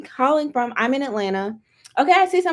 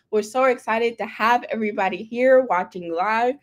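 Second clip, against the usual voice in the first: normal talking voice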